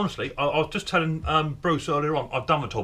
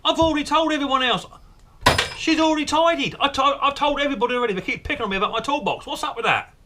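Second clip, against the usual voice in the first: much higher, louder whiny protest; exasperated outburst; 7 dB louder than usual. Acted. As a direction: blow up, fed up with everyone nagging. Exasperated at toolbox nagging.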